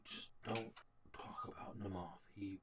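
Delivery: very soft, low hushed warning; whisper. Acted. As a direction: whisper it to one listener. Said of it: "and, and whispers".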